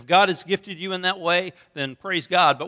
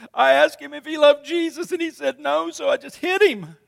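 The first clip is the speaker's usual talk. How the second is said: put-on crying child; 9 semitones higher than usual. Small boy explains to his dad why he punched the bully, high and teary. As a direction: plead your case as a little kid caught out. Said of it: "I said".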